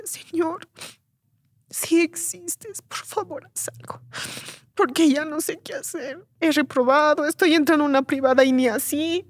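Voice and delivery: ruego agudo que empieza casi susurrado; súplica humilde recreada. Retold or imitated. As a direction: implorar sin altivez, en voz alta de tono. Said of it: "no lo dije con altivez". (ruego humilde y angustiado)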